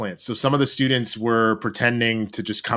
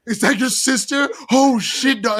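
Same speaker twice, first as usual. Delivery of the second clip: shocked audience; 14 semitones higher, 3 dB louder than usual. Retold or imitated. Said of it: "everybody's like".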